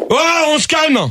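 high, sharp call to order; stern shout. Real, not acted. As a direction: shout them into calming down. Host orders callers to settle down.

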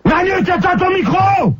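high-pitched exasperated call-out; irritated yell. Real, not acted. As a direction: yell at the offender to cut the mic. Host yells at caller to mute. (cri agacé, voix haut perchée)

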